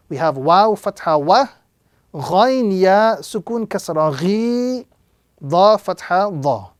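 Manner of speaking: normal talking voice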